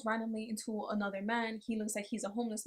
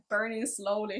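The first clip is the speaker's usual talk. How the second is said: normal talking voice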